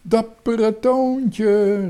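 high, loud, long-drawn cheer; calling out. A narrator's reading. Girl hailing the boy through the bars, drawn-out high call. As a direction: hail the rescuer with a stretched cry. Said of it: "riep Mariska".

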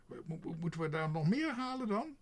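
high, loud spluttering question; exasperated crow, stuttering at high pitch; indignant stammer. A narrator's reading. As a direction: splutter upward in disbelief. Indignant crow stammers at more errands.

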